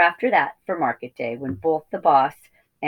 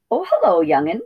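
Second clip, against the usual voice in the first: puffing; 2 semitones higher, 5 dB louder than usual. Acted. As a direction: puff it out of breath. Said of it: "puffed the old sheep".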